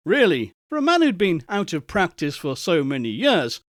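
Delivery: usual speaking voice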